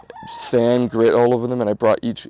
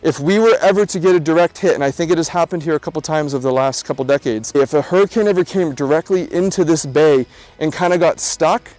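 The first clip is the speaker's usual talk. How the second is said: louder, higher presenter read over music; vlog voiceover; 6 semitones higher, 8 dB louder than usual. Real, not acted. Vlogger telling viewers about the hurricane, louder upbeat voiceover. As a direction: narrate to camera like a travel host.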